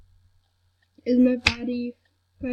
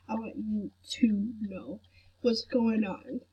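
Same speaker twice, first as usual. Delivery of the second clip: whisper; 2 semitones lower, 8 dB quieter than usual. Retold or imitated. Retold words to viewers, whispered.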